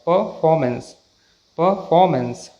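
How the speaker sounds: परफॉर्मेंस repeated as "puh-faw-mence"; silent r. Shown as a demonstration. Teacher repeats for learners, r silent. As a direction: say परफॉर्मेंस without r sounds. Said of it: "सही pronunciation".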